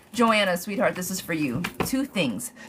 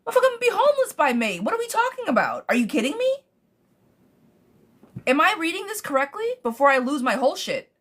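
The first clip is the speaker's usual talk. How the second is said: riled up; 8 semitones higher than usual. Real, not acted. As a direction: vent the outrage about losing a home. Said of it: "I gotta bring it down".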